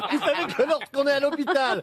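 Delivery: laughing. Real, not acted. Laughing through fluffed reading.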